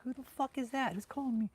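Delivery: high-pitched, baffled question; puzzled, pitched well up, without shouting; retold bewilderment. Soldier wondering who called his name, mock bewilderment.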